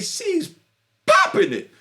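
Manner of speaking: high, loud burst; hyped. Real, not acted. Describing her dancing to listeners, hyped loud burst. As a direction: throw the words up in excitement.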